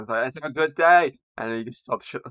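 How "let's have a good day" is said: raised, louder mock announcement; imitating Alan's address. Alan trying to speak to everyone, mock announcing. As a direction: call it out higher, like addressing a room. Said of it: "Alan tries to speak to everyone".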